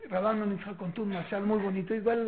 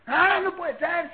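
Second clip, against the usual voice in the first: loud, high, drawn-out mock protest; acted angry outburst; 7 semitones higher, 6 dB louder, much slower than usual. Child upset by what others did, mock angry outburst. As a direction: play an indignant child's slow wail of protest. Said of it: "Se enojan".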